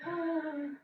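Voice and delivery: sharp frightened gasp; scared gasp. Shown as a demonstration. Teacher cueing the scared breath one last time. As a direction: take a startled breath in. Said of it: "like scared".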